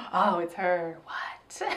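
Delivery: hushed mock surprise; mock whisper. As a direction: whisper it in fake awe. Acting surprise at reveal.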